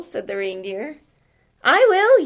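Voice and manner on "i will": much higher, much louder penguin yell; excited penguin yelling I will; eager yell. Acted. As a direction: shout it out, desperate to join. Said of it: "yelled the penguin".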